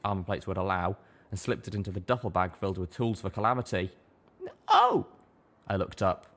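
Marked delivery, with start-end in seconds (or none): 4.7-5.1 very high, louder little-mare gasp of a word; a small pony suddenly remembering; a loud, high squeak of Oh!; sudden realisation. A narrator's reading. Littlepip voiced.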